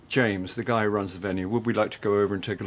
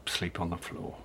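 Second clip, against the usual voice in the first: hushed; 2 semitones lower, 10 dB quieter than usual. Acted. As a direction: murmur it gently. Quiet offer late at night.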